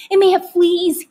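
high, loud call; called after. A narrator's reading. Father calling after mother. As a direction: throw it after her across the room. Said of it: "he called after her".

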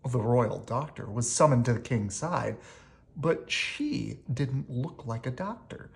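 ordinary delivery

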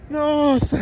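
high burst of relief; relieved exclamation. Real, not acted. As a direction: gasp it out, key found. Finds the lost truck key.

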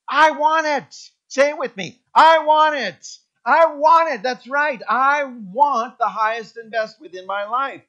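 loud, high preaching; full-force preaching. Acted. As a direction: proclaim it to the whole church.

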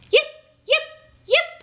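high yipping dog calls; sharp high dog yips from afar; husky yips. A narrator's reading. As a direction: yelp it out like excited huskies. Huskies yipping above wind.

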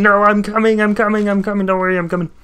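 loud, high urgent calls; hurry, I'm coming to help; real panicked reassurance. Real, not acted. Rushing to save teammate.

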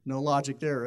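ordinary delivery

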